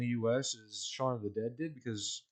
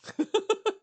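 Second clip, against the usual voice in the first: high giggle; 6 dB louder than usual. Real, not acted. Laughing at kitten.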